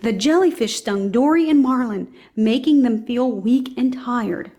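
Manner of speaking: usual speaking voice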